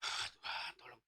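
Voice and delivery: very soft, voiceless plea; half-dead gasp. Retold or imitated. Driver like a living corpse prays, faint gasp. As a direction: breathe the words out, spent. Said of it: "seperti mayat hidup saya".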